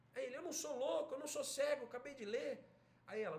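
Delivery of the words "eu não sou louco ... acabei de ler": high, indignant protest; angry father. Acted. Irritated husband defending his reading to his wife, indignant. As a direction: insist in acted exasperation. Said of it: "ele fica bravo".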